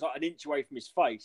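usual speaking voice

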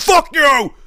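high, loud parting yell; mock shouted curse. The driver shouting at him while pulling off, high loud mock yell. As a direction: shout it as you speed away.

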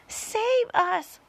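high-pitched plea for rescue; panicked cry. A narrator's reading. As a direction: cry out in terror. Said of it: "cried Miss Spider".

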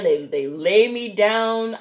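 usual speaking voice

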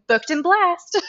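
high bright sing-song phrase; sing-song catchphrase. Retold or imitated. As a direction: chirp it like a catchphrase. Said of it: "I keep going".

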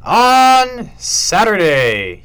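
slow, high, loud announcer opening. Acted. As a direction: draw it out like a stadium announcer. Announcing scores.

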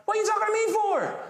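high, incredulous mock voice; high put-on voice of disbelief; Jesus's reaction. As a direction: play it up as a baffled Jesus. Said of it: "his reaction is kinda like".

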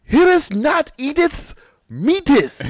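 high, loud, slow olde English herald; mock royal decree. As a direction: unroll the scroll and proclaim. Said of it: "Unravel my scroll".

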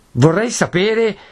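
Renzo's voice cracking high with rage; angry shout. A narrator's reading. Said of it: "gridò digrignando i denti e alzando la voce".

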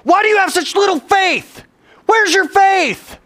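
high, loud rebuke; scolding. Acted. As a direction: act out a fierce telling-off. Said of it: "he starts scolding them".

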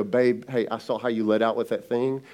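tender to wife. Retold or imitated. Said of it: "I can look at my wife and go".

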